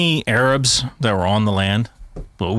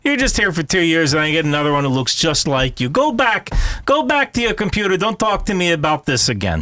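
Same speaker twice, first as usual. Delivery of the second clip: put-on 1 Israeli accent; 6 semitones higher than usual. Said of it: "It's my best Israeli accent I could do".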